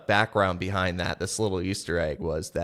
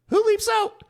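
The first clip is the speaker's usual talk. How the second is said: high, loud 'who leaps out'; incredulous question; 6 dB louder than usual. Retold or imitated. Arthur questioning Bedivere's plan, loud high disbelief. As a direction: ask loudly, high and baffled.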